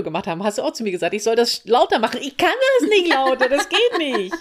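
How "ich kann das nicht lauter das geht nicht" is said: high, louder mock helpless wail; laughing protest. Retelling her failed try at louder clicking, laughing high protest. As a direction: protest loudly through laughter.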